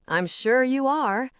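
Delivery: drawn-out grumpy retort; griping. A narrator's reading. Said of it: "he gripes back".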